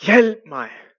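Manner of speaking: loud, desperate cry; shouting for help. Retold or imitated. Climber at the rope's end yells for help, loud and desperate. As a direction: yell it out, pleading. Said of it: "Han råber ud igen".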